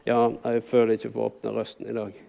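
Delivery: low, soft reluctant mumble; mumbling. Acted. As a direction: mumble it low, as if unwilling to open up. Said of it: "Mumler litt".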